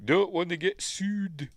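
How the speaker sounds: high, drawn-out mock warning; sing-song high with a long last word; cartoon impression. Quoting a Simpsons gag, high comic impression.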